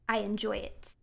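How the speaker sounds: breathy secret whisper. Acted. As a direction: confess it in a whisper. Whispered confession.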